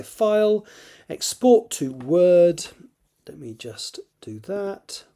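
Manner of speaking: put-on slow Scottish accent; drawled Scottish accent. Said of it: "I don't know why I'm doing Scottish accent".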